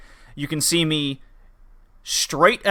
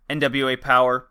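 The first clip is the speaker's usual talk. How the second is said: ordinary delivery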